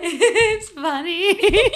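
very slow, high, sing-song giggle; imitating drunk Steph giggling about the pear, sing-song and slow; laughing "it's funny". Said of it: "And you're like".

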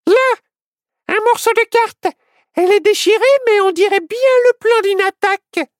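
very high, loud squeaky deputy voice; excited cry. A narrator's reading. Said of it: "l'adjointe s'écria".

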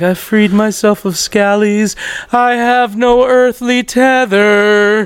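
slow, drawn-out floaty proclamation; mock-mystical chant, words held long; blissed-out stoner. Blissfully high self, retold.

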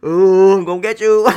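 high, loud playful menace, laughing through it; mock menacing taunt. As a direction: ham up a bogeyman coming for you. Imagined chasing people as extremist.

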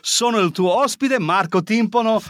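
co-host hamming it up as Italian host of the episode. Acted. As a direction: announce the show like a grand Italian host. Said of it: "how you say it in Italian".

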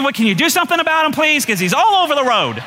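high, rushed plea rising into complaint; whiny, high-pitched appeal; exasperated pleading. Retold or imitated. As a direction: beg in comic exasperation. Pleads with God about driver.

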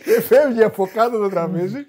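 loud, high, through real laughter; laughing hard. Real, not acted. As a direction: crack up while telling the punchline. Cracking up telling the anecdote.

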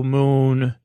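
usual speaking voice

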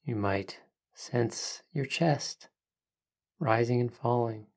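soft spa whisper. Acted. Leads a breathing meditation.

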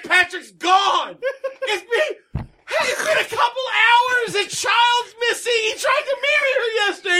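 high-pitched, shouted mock panic; frantic mother impression. Podcaster mimicking mom reporting missing child, mock frantic shouting. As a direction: act out a hammed-up hysterical mom, voice way up. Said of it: "She's like".

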